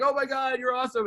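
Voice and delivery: high, starstruck gush, acted out; gushing fan. Young fan meeting favorite pitcher, gushing. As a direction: gush at your idol. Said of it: "I'm just like".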